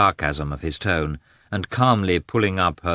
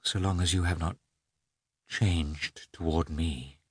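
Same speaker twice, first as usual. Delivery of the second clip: a wife softly asking her husband's loyalty; hushed plea; 9 dB quieter than usual. A narrator's reading. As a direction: drop to a near-private plea. Pleading after outburst.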